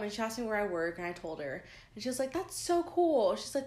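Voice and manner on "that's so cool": high, loud, drawn-out praise; sweet gushing. Retold or imitated. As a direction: imitate a girl gushing slowly. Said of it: "she was just so sweet".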